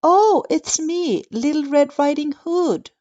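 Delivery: put-on a little girl calling sweetly through the door.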